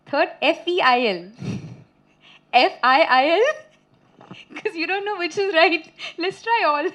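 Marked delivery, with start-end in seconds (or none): 1.4-7.0 higher voice bubbling with laughter; laughing. Real, not acted. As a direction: giggle through your own confession. Laughing at own bad spelling.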